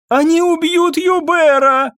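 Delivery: very high, drawn-out cry; panicked wail. A narrator's reading. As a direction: wail it in terror, loud and high. Maid bursts in screaming.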